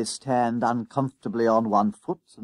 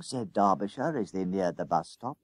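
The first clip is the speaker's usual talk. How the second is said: ordinary delivery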